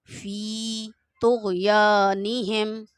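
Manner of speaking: very slow, drawn-out recitation. Shown as a demonstration. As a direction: recite carefully, each letter clear. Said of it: "आइन को सही से आपने अदा करना है".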